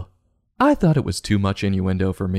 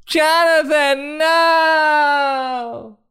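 put-on panicked kid voice; 3 dB louder, much slower than usual. Kid crying out in the tunnel.

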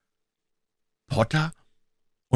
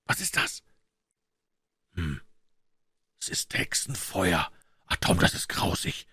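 hushed, barely voiced dread; awed, fearful whispering; frightened whisper; 4 dB quieter than usual. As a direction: whisper in horror at what you see. Boys whisper at eerie lights.

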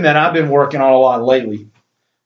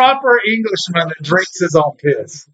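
higher mock proper-English voice; put-on British accent; 6 semitones higher than usual. Podcaster jokes about Bear Grylls, mock English accent. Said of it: "in some type of British accent".

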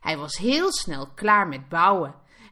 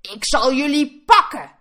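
loud, raised-pitch threatening roar; roaring wolf; 7 semitones higher, 7 dB louder than usual. A narrator's reading. Wolf threatens the three piglets, roaring. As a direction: roar it like a hungry storybook wolf. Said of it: "brulde hij".

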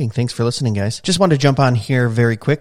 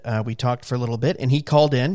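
usual speaking voice

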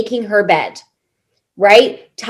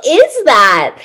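disbelief; 8 semitones higher, 4 dB louder, slower than usual. Retold or imitated. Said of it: "you're like".

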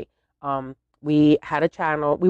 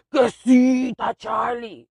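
insistent dad shout; 8 semitones higher, slower than usual. Retold or imitated. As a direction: insist hard as the stubborn father. Dad insisting in quoted argument.

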